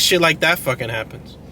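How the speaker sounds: ordinary delivery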